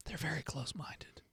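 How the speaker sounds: stage whisper aside. Acted. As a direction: breathe it secretly. GM mocking conspiratorial aside.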